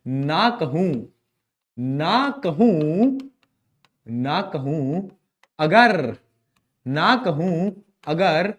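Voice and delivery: slow, drawn-out poetic recital with pauses; mock-grand shayari delivery for the class; shayari recitation. Shown as a demonstration. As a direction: recite it like a mushaira couplet, savouring each word. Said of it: "शायरी करते हैं".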